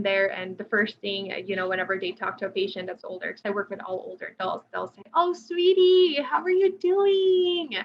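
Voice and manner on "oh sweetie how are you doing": high, loud, drawn-out syrupy cooing; exaggerated singsong condescension, pitched way up; elder speak. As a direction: imitate someone babying an older patient. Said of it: "having that kind of tone of voice".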